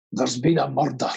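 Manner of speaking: low Scottish detective line, rolled "murder"; Scottish Taggart catchphrase. Acted. Scottish actor obliging fans with Taggart line, low Scots. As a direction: intone it gravely in broad Scots. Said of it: "sounds so much better in a Scottish accent".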